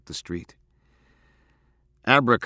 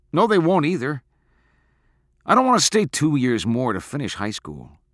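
louder, higher, heated argument; upset protest; 7 semitones higher, 6 dB louder than usual. A narrator's reading. Boy arguing about leaving town, upset and insistent. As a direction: push back with frustration.

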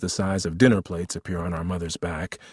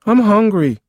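put-on loud, high child's whine; whining child; 13 semitones higher, 9 dB louder than usual. Seven-year-old behind the couch to big brother, whining. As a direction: demand food like a small boy, loudly. Child interrupts, demanding food.

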